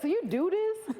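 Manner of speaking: high-pitched incredulous question; squeaky mock disbelief; retold astonishment. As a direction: imitate a stunned uncle. Retells uncle's astonished question.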